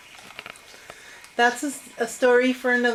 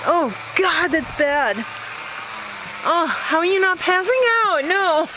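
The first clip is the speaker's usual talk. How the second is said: disgusted; 5 semitones higher than usual. Real, not acted. Reacting to horrible sewage smell.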